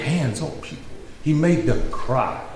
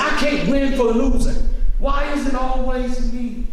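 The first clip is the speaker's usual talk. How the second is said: high whiny put-on lament; mock whining complaint; 12 semitones higher than usual. Mocking church people griping at home, high and whiny. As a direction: whine it, sorry for yourself.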